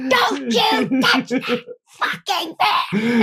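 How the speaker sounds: drawn-out, high screamed warning; hammed-up furious screech; screaming. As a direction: scream it slowly, word by word. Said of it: "I start screaming at them".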